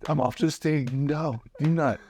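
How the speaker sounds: high, pleading protest; panicked plea. Retold or imitated. Comic offstage begging his friend not to call him back, panicked. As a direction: beg him to stop.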